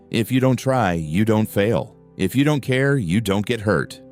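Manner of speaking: ordinary delivery, nothing marked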